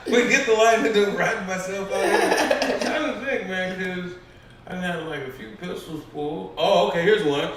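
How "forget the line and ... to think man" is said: laughing through the words, higher and louder; real giggling while explaining; talking through a real laugh. Real, not acted. Laughing at own forgetfulness.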